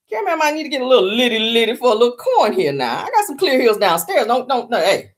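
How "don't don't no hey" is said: ordinary talk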